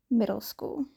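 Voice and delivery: ordinary delivery, nothing marked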